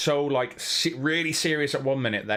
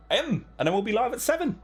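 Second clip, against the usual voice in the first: singsong; 11 semitones higher than usual. Pretend. Singsong announcement.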